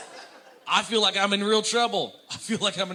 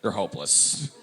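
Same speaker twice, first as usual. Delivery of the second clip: lower, halting blunt radio host giving up; Dave Ramsey; 7 semitones lower than usual. Retold or imitated. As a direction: lower host voice admitting defeat. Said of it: "just once I wanna hear Dave go".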